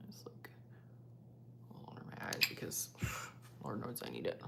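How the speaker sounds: fast unvoiced whisper, almost to herself. Real, not acted. Creator whispering to herself while doing concealer. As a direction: whisper it quickly to yourself.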